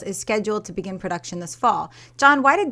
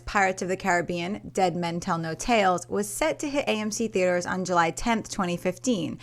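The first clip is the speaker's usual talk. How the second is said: normal talking voice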